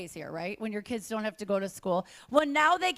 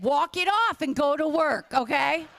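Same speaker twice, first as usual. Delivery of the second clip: brisk boomer mom; 2 semitones higher, 7 dB louder than usual. Retold or imitated. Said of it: "She said".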